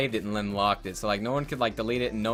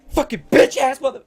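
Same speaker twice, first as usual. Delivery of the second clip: loud, high burst of cursing; imitated furious dad outburst, cut short; mad dad; 13 semitones higher, 11 dB louder than usual. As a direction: explode like an angry dad lashing out. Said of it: "gets mad, he's like".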